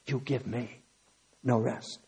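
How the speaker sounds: low, soft voice of God pleading; hushed plea. Acted. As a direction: let it sink almost to a murmur, low and soft.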